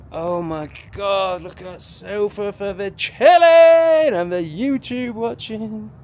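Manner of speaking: gushing over the sofa; excited. Real, not acted. Thrilled at the sofa in the new villa.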